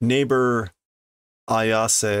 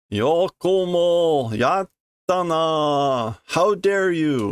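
mock outrage; 7 semitones higher, 3 dB louder, much slower than usual. Monster angry at being hit.

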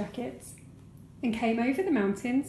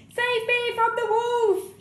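high, slow, loud plea; shriek; 13 semitones higher, 4 dB louder, slower than usual. A narrator's reading. Boy begging the laughing town, high slow shriek. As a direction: shriek for rescue. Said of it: "shrieked Harry".

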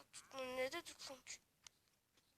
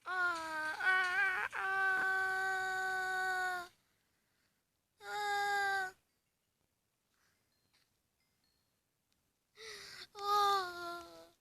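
drawn-out high screams; mock screams; 5 semitones higher, 7 dB louder, much slower than usual. Player screaming while falling into the pit. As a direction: scream as you fall.

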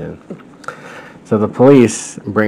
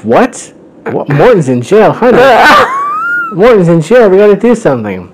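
high, loud comic outburst; hammed-up alarm, voice shooting up; shocked Bowser; 12 semitones higher, 6 dB louder than usual. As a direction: play a panicked dad shrieking in disbelief. Acting Bowser shocked by jail news.

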